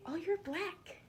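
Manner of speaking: put-on high, pointed childish blurt; little kid voice. A six-year-old classmate pointing out her color, high childlike voice. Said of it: "a kid around my age, say six years old".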